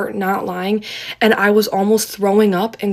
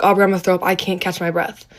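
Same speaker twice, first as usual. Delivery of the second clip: ordinary talk, nothing marked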